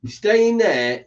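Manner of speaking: loud, slow order to a pet; firm command. Real, not acted. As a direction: tell the dog to stay put.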